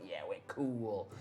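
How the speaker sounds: lightly voiced low, quiet thug; low and slow, a hint of prison gang; skull-faced gang member. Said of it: "a group of these kind of skull-faced guys".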